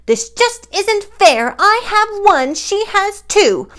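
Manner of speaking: shouted. Acted. Gertrude yells in jealousy at the unfairness. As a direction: shout it like a jealous little bird. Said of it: "she shouted".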